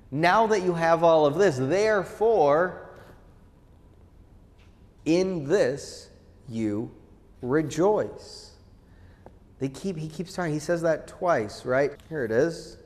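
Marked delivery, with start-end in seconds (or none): none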